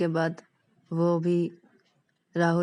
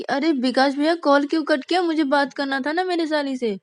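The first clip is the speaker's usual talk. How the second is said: put-on higher, louder complaining young man; pitched-up plaintive Rahul voice; whiny young brother-in-law; 9 semitones higher, 6 dB louder than usual. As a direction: protest like a cheated younger brother. Rahul complains call was cut.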